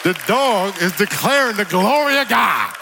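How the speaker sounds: loud, high, drawn-out proclamation; exultant preacher shout. Real, not acted. Preacher proclaiming that a dog declares God's glory, exultant shout. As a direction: shout it out slowly to the whole church.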